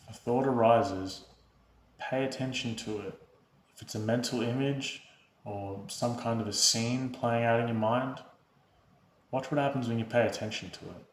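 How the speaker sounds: soft, unhurried meditation voice with long pauses; guided meditation. Real, not acted. Host leading viewers through breath and body awareness, calm guided meditation. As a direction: guide them gently into stillness, hushed and even.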